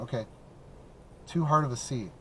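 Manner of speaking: normal talking voice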